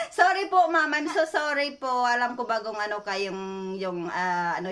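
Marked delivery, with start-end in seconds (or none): 0.1-1.9 raised, louder rush of apology; giggly apology. Real, not acted. Prankster apologising to ma'am after the reveal, giggly and flustered. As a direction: apologise through nervous laughter.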